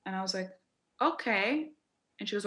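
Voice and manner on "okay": puzzled okay. Retold or imitated. Unsure reply to a surprising remark.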